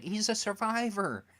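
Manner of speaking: high, lifted voice as the neighbourhood guys, impressed; mock admiring guys. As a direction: lightly play the local guys hailing a survivor. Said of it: "they're like".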